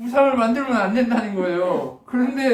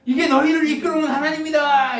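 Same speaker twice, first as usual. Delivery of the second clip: higher, put-on voice proclaiming the idol; mock announcement of a golden calf god; hammed-up Aaron presenting the calf; 9 semitones higher, 4 dB louder than usual. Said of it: "이렇게 얘기하는 거예요".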